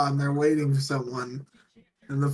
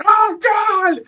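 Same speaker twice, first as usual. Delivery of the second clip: high, loud mock shriek; panicked yelp; 8 dB louder than usual. Pilot with soda up his nose, high mock shriek. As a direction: squeal in hammed-up panic. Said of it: "And he's like".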